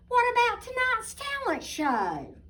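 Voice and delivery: high, eager fans asking; chirpy crowd of fans asking; curious fans. Acted. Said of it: "they asked".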